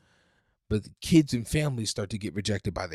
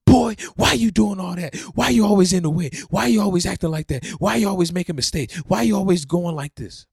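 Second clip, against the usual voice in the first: put-on loud, high, nagging scolding of a child; exasperated parent shouting at child; irritated parent; 8 semitones higher, 7 dB louder than usual. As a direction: yell at the kid like a fed-up parent. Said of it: "they start saying".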